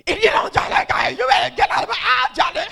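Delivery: full-force shout, high and fast; mock-sweet screaming. Laboring wife ordering her husband to drive, furious screaming. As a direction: scream the threat as if it were your sweetest voice. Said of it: "in my sweetest voice and said".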